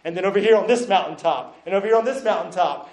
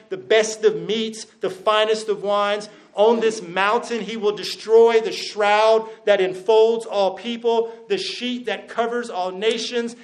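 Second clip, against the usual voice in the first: higher, even-pitched reading voice; scripture reading; 4 semitones higher than usual. Acted. Preacher reads Isaiah's feast promise to the congregation, scripture reading. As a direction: read it out in a raised, measured proclamation.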